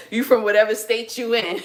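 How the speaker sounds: real laugh breaking into the words; amused, laughing dismissal; laughing teasing. Real, not acted. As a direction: tease them while cracking up. Teasing upstate New Yorkers, laughing.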